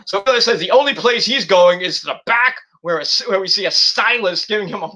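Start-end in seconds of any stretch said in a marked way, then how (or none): none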